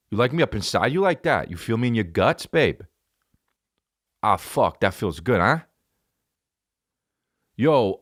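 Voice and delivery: hammed-up Jersey lover talk; Jersey accent. Comic acting out his lovemaking, serious Jersey accent. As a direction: lay on a thick New Jersey accent mid-romp. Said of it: "I have a fucking serious Jersey accent".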